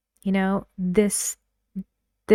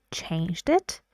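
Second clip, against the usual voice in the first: "Changed it" with the end swung up; rising query on "Changed it"; questioning repeat; 3 dB quieter than usual. Shown as a demonstration. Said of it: "he repeats it in a questioning way".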